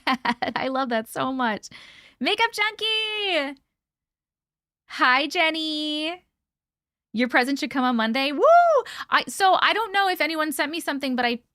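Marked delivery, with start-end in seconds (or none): 2.2-6.2 high, slow singsong hello; singsong greeting. Real, not acted. Greeting chatters by name, high singsong. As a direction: sing out the names.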